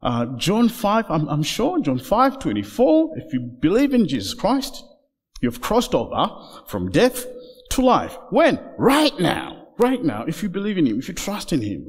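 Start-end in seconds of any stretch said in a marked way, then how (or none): none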